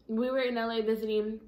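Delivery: talking through fingers; speaking with finger at lips. Real, not acted. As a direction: hand partly covering mouth. Seen 0: Fingers rest at lips and nose from 1.0s, partly covering mouth.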